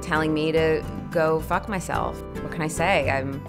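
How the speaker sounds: usual speaking voice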